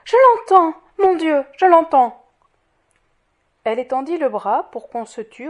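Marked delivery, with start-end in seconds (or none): 0.0-2.2 loud, much higher cry of joy; rapturous exclamation at full voice; ecstatic cry. A narrator's reading. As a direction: cry out, overwhelmed with hope. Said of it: "Fantine s'écria".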